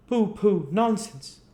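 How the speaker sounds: mock the sweep for haggling; jeering gentleman.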